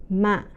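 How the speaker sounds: "mạ." cut short as it drops; heavy falling tone. Shown as a demonstration. Teacher showing learners the fourth tone, heavy falling. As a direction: drop "mạ." heavily and stop it short. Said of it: "the heavy and mid".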